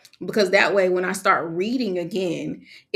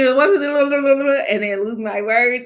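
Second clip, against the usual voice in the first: slow stumbling; 6 semitones higher, 5 dB louder, much slower than usual. Pretend. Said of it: "your girl don't got time for that".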